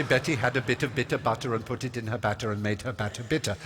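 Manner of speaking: measured, evenly paced tongue twister; slowed-down recital. Retold or imitated. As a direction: say each word distinctly, without racing. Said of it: "eventually I would learn to say".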